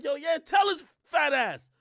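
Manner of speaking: loud, high acted cheer from the crowd; egging on. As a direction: shout it like a bystander goading someone.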